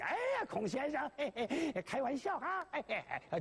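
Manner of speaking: very high ingratiating greeting; fawning politeness. Acted. Shoemaker flattering Mr Kong, high and fawning. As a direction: fawn over him with a giggle. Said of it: "要以往小鞋匠见着王平啊，很客气".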